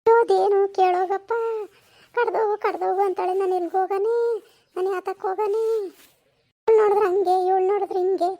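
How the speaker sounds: hammed-up whining cry; tearful complaint. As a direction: keep wailing to your son. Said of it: "ಅಳ್ಬೇಡ". (ಅಳುತ್ತಾ ದೂರುವ ದನಿ)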